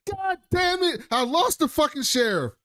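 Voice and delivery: high-pitched, strained mock cursing; retold mad outburst. Mimicking Bernard after losing the sheriff, mad. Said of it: "he was so mad".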